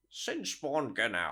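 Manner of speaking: very slow, stretched monster voice; monster voice imitation. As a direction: drawl the name like the creature. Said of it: "I liked your Sinspawn Gnau".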